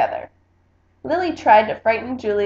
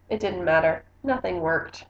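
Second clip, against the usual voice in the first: ordinary delivery, nothing marked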